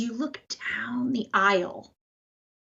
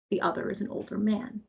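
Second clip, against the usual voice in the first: ordinary talk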